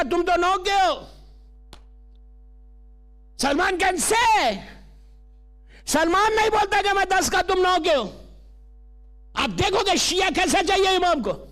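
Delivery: high, projected sermon voice; preaching. Acted. Preacher citing Salman to the congregation, preaching. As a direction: press the lesson on the listeners.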